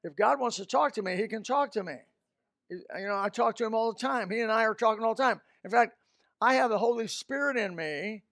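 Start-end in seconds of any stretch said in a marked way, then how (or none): none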